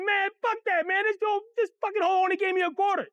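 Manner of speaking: high, whiny mock crying; tearful complaint. Boy shows his friends the quarter, tearful outrage. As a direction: wail it like a cheated kid. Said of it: "stop being a bitch".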